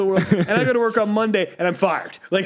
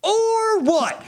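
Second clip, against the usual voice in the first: very high, stretched mock challenge; childish defiance; 13 semitones higher than usual. Mom says stop acting like a child, childishly defiant. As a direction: taunt it high with a long OR. Answers mom's telling-off defiantly.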